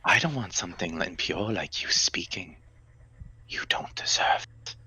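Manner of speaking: soft, cold, contemptuous whisper; menacing whisper. Acted. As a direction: whisper down at something impure. Creature keeps whispering to victim.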